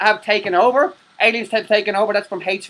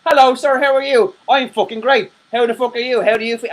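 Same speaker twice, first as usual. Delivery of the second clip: higher, louder chirpy greeting voice; mock conversation; 5 semitones higher, 4 dB louder than usual. Acting out a talk with himself on camera, higher and louder. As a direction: play a cheery stranger greeting himself. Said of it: "I'm gonna go".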